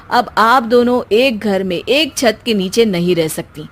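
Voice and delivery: ordinary delivery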